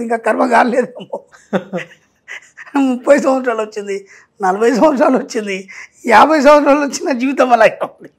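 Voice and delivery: amused, words breaking into laughter; laughing. Real, not acted. Speaker joking with host about karma still unburnt, laughing. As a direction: talk through a chuckle.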